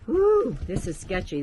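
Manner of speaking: excited whoop. Real, not acted. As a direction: yelp at the shaky floor. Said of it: "I got all excited".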